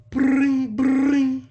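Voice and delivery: high mock ring-ring; phone ring. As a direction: sing out the ringtone. Imitating phone ringing.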